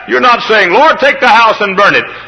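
high ringing preacher's cry; full-force preaching. Acted. Preacher to his congregation, full-force preaching. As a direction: lift it up like a revival preacher.

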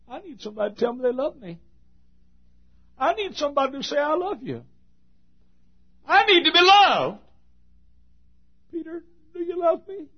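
high, pleading voice of the Savior. Acted. Preacher voicing the Savior begging for love.

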